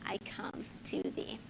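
breathy whisper at the close of the verse. Acted. Pastor ending the hymn verse to congregation, whispered. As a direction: let the words fade into a whisper.

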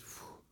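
soft blow on a dandelion; short airy whoosh, as if blowing out; puff of breath. Shown as a demonstration.

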